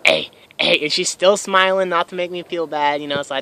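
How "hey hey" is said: short, abrupt barks; punched, repeated yelps; barked. Retold or imitated. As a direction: bark each one out separately. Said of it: "I'm still barking in her face".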